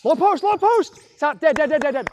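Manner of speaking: loud high shout across the court; shouting play calls. Real, not acted. Coach yelling the call to players mid-play, loud and high. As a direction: yell the call over the play.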